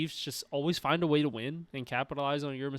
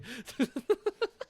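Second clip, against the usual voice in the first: high laugh; 10 semitones higher, 4 dB quieter than usual. Real, not acted.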